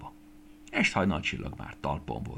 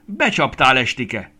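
loud, high angry outburst; furious accusation; 5 semitones higher, 10 dB louder than usual. A narrator's reading. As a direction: accuse her hotly, at full voice. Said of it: "A bál úrnője vörösen szikrázott". (dühös, hangos vádaskodás)

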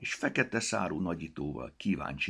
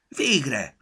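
high, loud cry of relief; 9 semitones higher, 9 dB louder than usual. A narrator's reading. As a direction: shout it out at last. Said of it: "kiáltott fel".

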